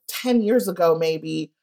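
ordinary talk, nothing marked

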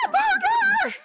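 high mock scream of murder; child's playful scream. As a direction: shriek it like a kid. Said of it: "the murder scream".

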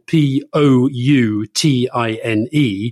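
ordinary delivery

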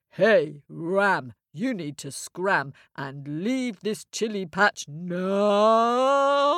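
slow cow voice with a long mooed last word; mooing cow. Acted. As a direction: moo out the order, stretching "now".

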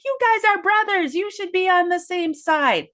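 high-pitched exasperated plea, acted out; mock mom scolding. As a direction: imitate a fed-up mother scolding squabbling sons. Voicing an exasperated mom scolding sons.

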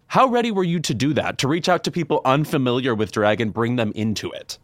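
ordinary delivery

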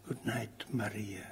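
tender whispered name; whispering. Acted. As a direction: breathe her name softly. Ghost's soft good night.

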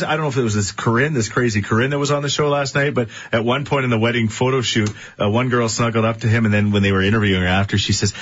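normal talking voice

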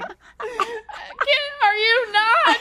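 high, loud sobbing squeak; imitating a friend choking on tears; crying voice of a girlfriend in the theater. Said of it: "she had tears streaming down her face, just sobbing".

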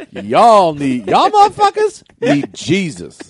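slow, drawn-out sermon line; drawn-out preacher voice; slow preachy shirt voice. Pretend. Shirt's voice again.

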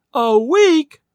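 high, loud, incredulous question; shocked disbelief. A narrator's reading. Old lady hearing of the delay, shocked. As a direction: echo it back aghast.

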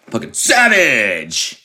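shouted mock sneeze, drawn out; hammed-up sneeze-shout; Logan Paul sneeze. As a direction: sneeze the word as a long, high yell. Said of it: "That's how Logan Paul sneezes".

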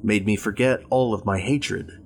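ordinary delivery

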